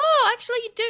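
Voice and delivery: high, loud retold surprise; sudden realisation. Host retelling his blunder to a guest, surprised realisation.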